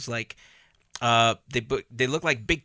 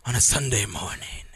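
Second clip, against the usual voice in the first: ordinary delivery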